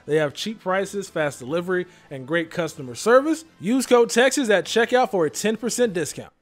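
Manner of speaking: upbeat, loud sponsor pitch; ad read. Acted. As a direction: sell it like a radio spot.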